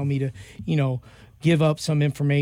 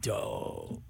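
proud murmur; 4 semitones lower, 9 dB quieter, much slower than usual. Retold or imitated. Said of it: "I was like".

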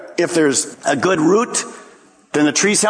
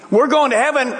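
smug boast; 8 semitones higher than usual. Retold or imitated. Pharisees boasting of heaven.

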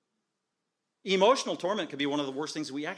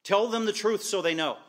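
urgent exhortation; 6 semitones higher, 6 dB louder than usual. Real, not acted.

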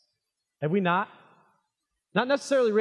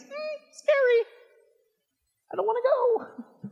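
very high, whiny mock fear; mock scared. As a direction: play a scared reluctant churchgoer. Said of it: "sometimes the pain is".

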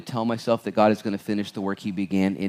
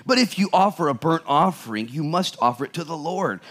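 raised, emphatic Bible reading voice; scripture reading; 8 semitones higher than usual. Acted. Pastor reading Judges to the church, emphatic reading voice. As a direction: proclaim the verses to the congregation. Reads verses fifteen to sixteen.

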